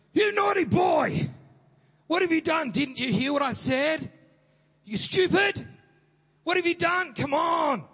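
high, loud shouted scolding; furious parent berating a child; angry German parent scolding. Retold or imitated. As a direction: yell at the naughty boy like a fed-up parent. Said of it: "my parents would go".

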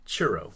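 CHURRO with an English R; common pronunciation. Shown as a demonstration. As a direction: say churro as most English speakers do. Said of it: "Most people pronounce it as".

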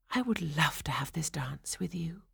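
soft, unvoiced whisper. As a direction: whisper it to a dancing partner. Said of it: "she whispered".

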